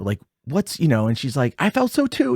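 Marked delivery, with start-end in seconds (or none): 1.6-2.3 high, acted tearful reply; imitating tearful girl. Girl answers the boy through tears, high and emotional. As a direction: pitch it way up, as if crying. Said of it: "And she's crying".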